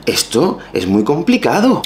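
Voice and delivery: high puzzled inner voice; confused inner voice. Retold or imitated. Learner puzzling over Spanish idioms, baffled high voice. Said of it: "Y te quedas pensando".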